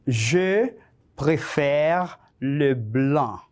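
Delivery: slow, word-by-word French dictation; measured teacher's dictation with spaced words. Shown as a demonstration. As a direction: dictate it slowly for learners to write down. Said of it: "te voy a dictar cinco oraciones cortas".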